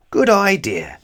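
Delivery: high, bright eager yes; eager agreement. Acted. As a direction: jump at the idea.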